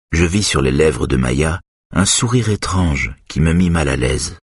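plain reading voice

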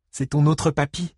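high, forced-cheery voice; falsely cheerful. A narrator's reading. Grandmother introducing the other grandpa, falsely cheerful. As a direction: keep up the fake cheer. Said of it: "d'une voix faussement enjouée".

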